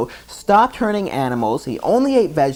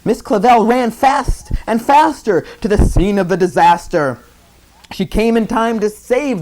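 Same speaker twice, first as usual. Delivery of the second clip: higher, louder urgent telling; excited storytelling; 9 semitones higher, 4 dB louder than usual. A narrator's reading. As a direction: tell it breathlessly. Narrating the chaotic chase.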